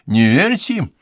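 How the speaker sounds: high, loud warning; angry retort. A narrator's reading. Old woman warns the sitters, angry retort. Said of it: "отрезала она сердито".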